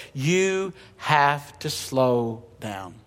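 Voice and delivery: very slow and soft, letting each word hang; slow, hushed, deliberate. Shown as a demonstration. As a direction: drag the words out to show slowing down. Preacher enacts slowing down.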